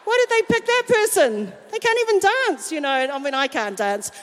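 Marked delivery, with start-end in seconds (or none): 0.0-2.7 higher, catty complaint about a rival singer; a touch of mock sourness; sour jealous griping. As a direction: gripe with petty jealousy. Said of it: "you start to go".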